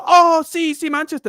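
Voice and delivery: high, excited imitation of Spanish speakers; exaggerated Spanish-accented recognition. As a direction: burst out loudly in a Spanish accent, suddenly understanding. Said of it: "say it in their accent".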